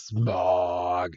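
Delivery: low, soft drawn-out creature voice; Koffing voice. Acted. Koffing releasing its smog, low creature voice. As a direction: breathe the word out low and long.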